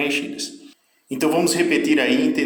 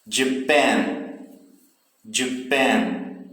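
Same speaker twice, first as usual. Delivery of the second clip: JAPAN with a short closed start and a long open final syllable; closed start, open ending; much slower than usual. Shown as a demonstration. Teacher drilling for students, Japan stressed on the open last syllable. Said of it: "início mais fechado e o final aí mais aberto".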